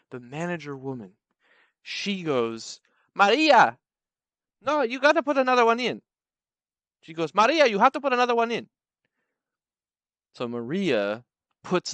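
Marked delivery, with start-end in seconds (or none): 3.1-6.0 higher, louder manager giving an order; mock calling out. Manager telling Maria to put another pizza in, mock calling out. 7.3-8.7 high, loud, quick manager's order; mock calling out. Manager repeating her order to Maria, mock calling out.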